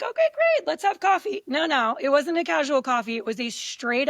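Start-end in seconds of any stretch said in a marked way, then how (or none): 0.0-1.4 mock cheerful reply. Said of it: "I'm like".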